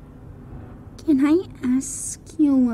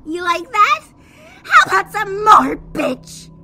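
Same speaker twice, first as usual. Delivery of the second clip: higher, louder gleeful taunt; taunting yell; 7 semitones higher, 5 dB louder than usual. Acted. Sadie spraying the creature, taunting yell. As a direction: yell it with a smirk. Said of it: "Sadie yelled with a frown and a smirk".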